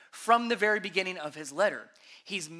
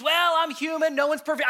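higher, louder mock excuse-maker; louder, higher voice of a churchgoer excusing himself; 7 semitones higher, 6 dB louder than usual. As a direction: shrug it off like someone making excuses. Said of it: "we can use that as an excuse".

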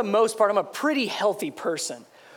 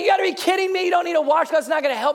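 mocking wife; 7 semitones higher, much faster than usual. Wife mocking her husband's washcloth request, high and scoffing. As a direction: scoff at him like his wife would. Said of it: "She's like".

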